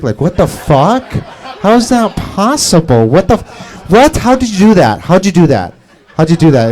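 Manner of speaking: astonished questions, hammed up; mock disbelief. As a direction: act out people baffled by a magic trick. Acting out people's disbelief at his heritage.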